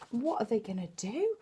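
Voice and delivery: ordinary delivery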